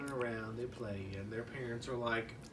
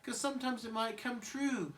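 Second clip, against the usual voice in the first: put-on much higher timid baby warning; worried Chucky; 10 semitones higher than usual. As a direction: squeak a nervous warning. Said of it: "Chucky's like".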